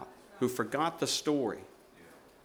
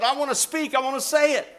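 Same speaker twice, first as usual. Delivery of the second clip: higher, pleading woman's voice; retold woman's plea; 11 semitones higher, 6 dB louder, faster than usual. Weeping sister begging to speak to the church, higher and pleading. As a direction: let her insist she speak for herself.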